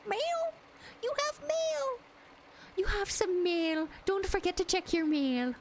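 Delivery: high, singsong notification voice; high mock-cute mail alert; silly high voice. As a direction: chirp it like a cheery bot. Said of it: "robot voice".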